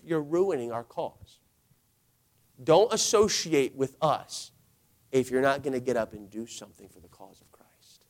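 quiet, weighty exasperation, sinking toward a whisper; fed-up James. Acted. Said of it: "James has had it up to here".

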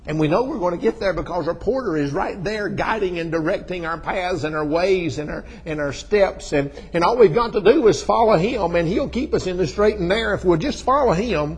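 loud, high preacher's storytelling; full-force preaching. Acted. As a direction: belt it out to the congregation.

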